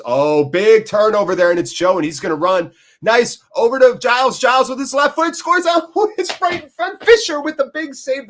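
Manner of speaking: loud, high, racing match call; real thrill at a shot on goal; excited play-by-play. Real, not acted. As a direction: build to a high shout as the chance unfolds. Live call of a scoring chance.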